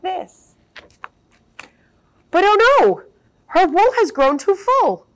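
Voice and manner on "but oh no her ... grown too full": rising alarmed exclamation. A narrator's reading. Narrator to children, mock dismay at overgrown wool. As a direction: gasp it out as trouble starts.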